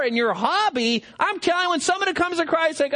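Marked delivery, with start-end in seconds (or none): none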